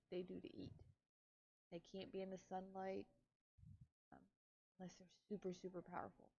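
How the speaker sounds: ordinary delivery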